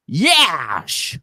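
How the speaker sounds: a one-word Scottish "Yash!" as Sean Connery; Sean Connery impression. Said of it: "So this is my Sean Connery".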